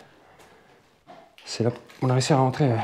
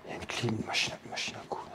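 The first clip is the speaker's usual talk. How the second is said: whispering; 4 semitones lower, 14 dB quieter than usual. Real, not acted. Hushed look round abandoned house.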